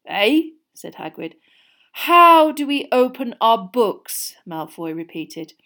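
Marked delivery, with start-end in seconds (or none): 0.0-0.5 big booming hearty giant; a jolly loud gamekeeper; Hagrid voice. A narrator's reading. 1.9-4.3 slow, mocking, high sneer; sneering drawl. A narrator's reading. Malfoy voiced in story.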